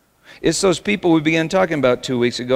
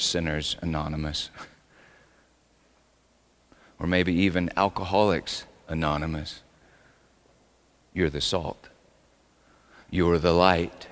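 much softer and lower, "You're the salt" almost murmured; quiet aside; 6 semitones lower, 6 dB quieter than usual. Shown as a demonstration. Pastor softly repeating the verse to his congregation, hushed. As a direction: pull right back and let "You're the light" drop quietly. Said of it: "you is in the emphatic position".

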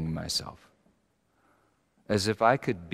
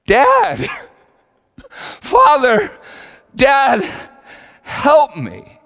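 desperate high shouts, much louder, to a father; crying out for help; 13 dB louder, much slower than usual. Retold or imitated. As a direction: call out to Dad as if drowning. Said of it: "I just had to cry out".

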